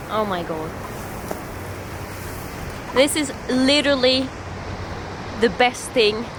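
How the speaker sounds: ordinary talk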